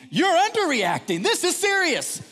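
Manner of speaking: high, loud comic alarm; outraged retort. Retold or imitated. Overprotective dad correcting his wife, outraged. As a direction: insist with mounting hysteria.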